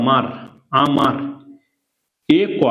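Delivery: ordinary talk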